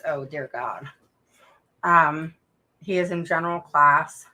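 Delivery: usual speaking voice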